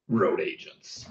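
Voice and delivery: low, hushed show-title tag; quiet, deep put-on announcer aside; mock title voice. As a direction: drop low and soft for a teasing title drop. Echoes the show's put-on title voice.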